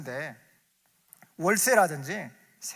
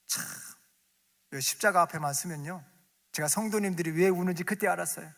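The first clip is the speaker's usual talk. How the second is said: ordinary delivery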